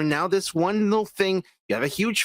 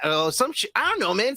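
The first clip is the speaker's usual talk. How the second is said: normal talking voice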